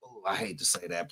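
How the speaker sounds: ordinary delivery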